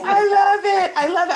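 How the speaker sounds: high, loud, thrilled repetition; delighted. Real, not acted. Host gushing with excitement over the guest's point. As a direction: gush with real delight.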